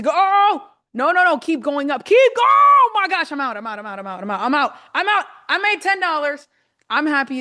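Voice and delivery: high, loud cries tumbling into repeated words; real thrilled outburst, voice shooting up; excited. Real, not acted. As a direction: cheer it on, then burst out in relief. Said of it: "wow, that just excited me".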